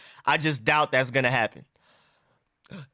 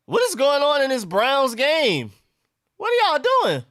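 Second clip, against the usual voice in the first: very high, squealed exasperation; high-pitched disbelief; 12 semitones higher, 4 dB louder than usual. Real, not acted. Fan watching the Browns game go wrong, high-pitched disbelief. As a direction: cry out at the game in falsetto dismay.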